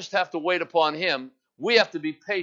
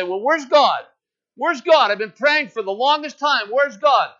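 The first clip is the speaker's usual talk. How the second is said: louder, higher, frustrated "Where's God?"; impatient complaint; 5 semitones higher, 5 dB louder than usual. Retold or imitated. Congregation imitated complaining to God, louder and higher. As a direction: cry out like a weary, impatient believer. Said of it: "we say".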